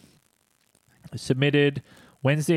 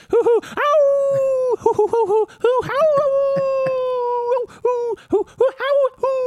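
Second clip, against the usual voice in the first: high hoots and howls; mimicking owls and coyotes howling; 6 dB louder, slower than usual. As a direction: hoot and howl like a forest at night. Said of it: "the coyotes begin howling with them".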